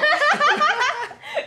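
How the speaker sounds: loud, high burst of laughter; real giggling fit; high shrieking laugh. Real, not acted.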